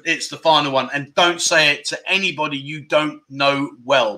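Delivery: ordinary talk, nothing marked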